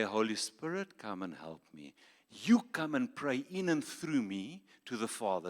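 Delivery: ordinary talk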